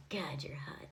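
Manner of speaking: low, hushed swooning; mock lustful whisper. Woman flirting with the bragging quarterback, mock lustful. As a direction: whisper it low in fake desire.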